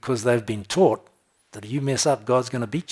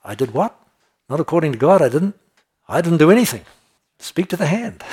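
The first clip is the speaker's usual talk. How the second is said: sassy comeback; 5 semitones higher, 7 dB louder than usual. Retold or imitated. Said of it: "The answer is".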